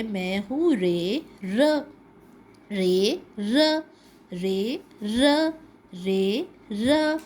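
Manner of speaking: slow sounding out of "رے، ر"; letter Ray speaking. Shown as a demonstration. Teacher voicing the letter Ray for kids. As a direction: stretch "رے، ر" slowly like a letter introducing itself. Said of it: "تو رے کہتا ہے".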